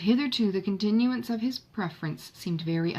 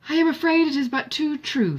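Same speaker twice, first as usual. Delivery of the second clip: Marianne's eager reply; 8 semitones higher, 7 dB louder than usual. A narrator's reading. Marianne replies to Edward, narrated.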